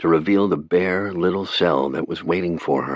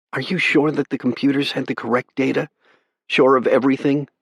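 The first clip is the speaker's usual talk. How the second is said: anxious questioning; 9 semitones higher than usual. A narrator's reading. Brother desperately checks.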